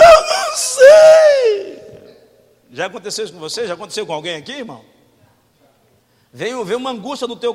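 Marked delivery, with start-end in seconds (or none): 0.0-2.2 high, slow, wailing cry; mock sobbing wail. Crying person answers a friend in a slow high wail. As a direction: wail it out like a helpless sob.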